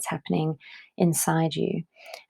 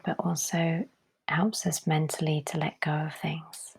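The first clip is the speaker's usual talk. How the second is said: hushed, unhurried calm; guided meditation; 5 dB quieter than usual. Real, not acted. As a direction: gently guide them into letting go, softly. Leads a breathing meditation.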